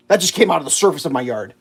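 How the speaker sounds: usual speaking voice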